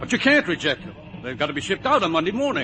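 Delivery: don't let him reject them; alarmed protest. Acted. Boss panics over rejected batch.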